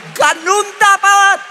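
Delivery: high, drawn-out, full-force shout. Real, not acted. Preacher tells the church that's how to pray, shouting. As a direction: belt it out and hold the end.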